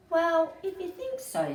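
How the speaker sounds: a hesitant, unsure jackdaw; doubtful jackdaw. Acted. Jack doubtfully answering Harry about eggs. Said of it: "said Jack".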